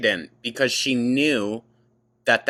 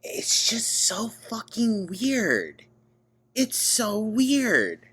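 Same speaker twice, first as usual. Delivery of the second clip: incredulous; 10 semitones higher, slower than usual. Real, not acted. Disbelief at the claim.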